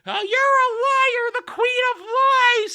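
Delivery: high, loud, drawn-out heckle; mock heckler yell. Audience heckler yelling at the queen, hammed-up high shout. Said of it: "somebody yells from the audience".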